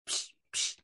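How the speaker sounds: soft voiceless "psh" puffs; imitated snoring puffs. A narrator's reading. Animals hearing a sound under the bed, hushed puffs. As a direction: puff out two hushed little snores. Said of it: "snoring softly".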